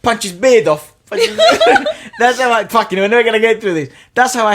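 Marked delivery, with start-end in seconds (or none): 1.1-2.1 very high loud laugh; shrieking laugh. Real, not acted. 2.2-4.5 high, loud laughing complaint. Real, not acted. Laughing, losing composure.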